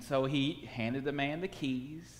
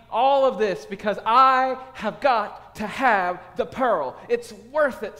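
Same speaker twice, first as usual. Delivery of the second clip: higher and louder, pleading over the pearl; 7 semitones higher, 8 dB louder than usual. A narrator's reading. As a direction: give away everything to get it.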